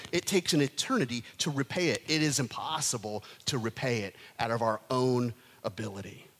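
ordinary talk

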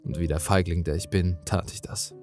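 ordinary delivery, nothing marked

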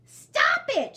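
very high, loud shout. Acted. Bird yelling at Jack, shouting. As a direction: yell stop it. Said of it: "shouted Bird".

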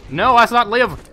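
loud, high cries of objection; exasperated gamer outburst; indignant protest. Real, not acted. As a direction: shout it down in exasperation. Protests failure to survive.

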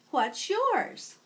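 put-on old teacher welcoming a new student; kindly teacher. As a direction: greet a new pupil warmly. Teacher asks name.

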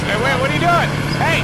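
high startled shout; alarmed. Real, not acted. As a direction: call out in alarm. Teammate doing something crazy.

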